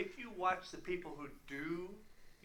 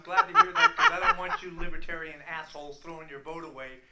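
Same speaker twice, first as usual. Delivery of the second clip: laughing rant; 12 dB louder than usual. Real, not acted. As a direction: laugh while ranting.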